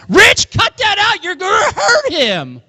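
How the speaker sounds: very high, drawn-out mock yell; mom's yell. Mom yelling into the living room at dad, high imitated holler. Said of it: "yelling in the living room".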